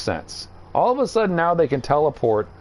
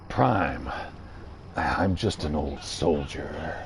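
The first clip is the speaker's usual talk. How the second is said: much lower, softer and slow gruff soldier voice; Ultra Magnus impression; 10 semitones lower, 7 dB quieter, slower than usual. Ultra Magnus telling Prime he's an old soldier, low and gruff. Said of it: "I unintentionally just sounded like Solid Snake".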